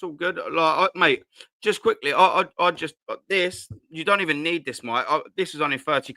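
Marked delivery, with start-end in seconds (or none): none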